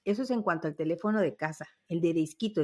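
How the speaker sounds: ordinary delivery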